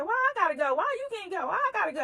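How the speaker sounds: refuse, exasperated; protesting. Retold or imitated. Protesting boss's order to come.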